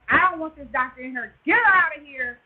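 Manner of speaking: loud, worked-up retold demand; freaking out. Patient ordering the doctor out of the room, freaking out. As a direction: throw someone out at full volume. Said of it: "Like, I'm freaking out".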